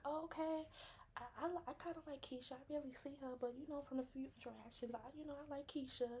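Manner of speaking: high, fast hedging; hedging friend. Retold or imitated. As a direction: rush through it up high. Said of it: "your Scorpio rising like".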